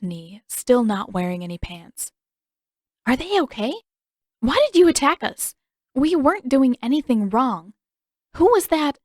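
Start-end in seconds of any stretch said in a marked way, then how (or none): 3.1-9.0 high and loud, tumbling out; tied-up girl begging captors for answers; frantic questions. A narrator's reading. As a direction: pour out panicked questions.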